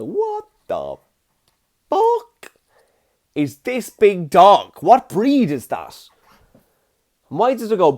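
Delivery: a startled puppy seeing its first horse. Pretend. Owner voices the puppy meeting a horse in a high squeaky voice. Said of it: "I bet he was like".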